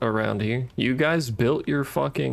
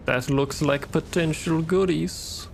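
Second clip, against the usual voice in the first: sing-song greedy; 6 semitones higher, slower than usual. Pretend. Stretches goodies high.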